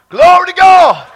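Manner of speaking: high, loud, exultant shout; shouted praise. Real, not acted. Preacher giving glory to God, exultant shout. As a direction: shout it out in jubilation.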